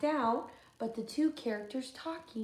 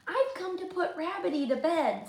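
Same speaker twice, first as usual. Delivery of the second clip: little Freddie; 6 semitones higher than usual. Acted. Freddie speaks to George.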